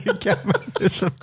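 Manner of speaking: laughing through the line. Real, not acted. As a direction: crack up while saying it. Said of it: "Even when he says it".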